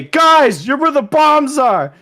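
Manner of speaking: high, loud mock yell; shouted warning. Soldier warning comrades about the bombs, high mock shout. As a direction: yell it up high like an urgent alarm.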